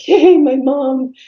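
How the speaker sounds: put-on reliving a little girl's joy at going home; thrilled child. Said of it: "And I was so thrilled".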